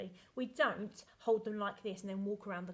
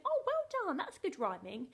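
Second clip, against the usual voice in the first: high, loud mock praise; bright burst of pretend approval, much higher; imitating delighted mum; 9 semitones higher, 5 dB louder than usual. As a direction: gush like an impressed mum. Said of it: "At first she said".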